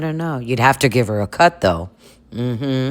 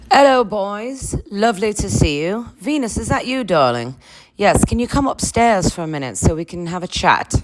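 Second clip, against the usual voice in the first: Queen Mother voice; 6 semitones higher than usual. Acted. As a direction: greet the boys like royalty from above. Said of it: "here is the Queen Mother herself now".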